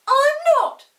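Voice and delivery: very high, loud denial; indignant girl. Acted. Molly denies being horrid, very high. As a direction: squeal it out in protest. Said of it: "said Molly".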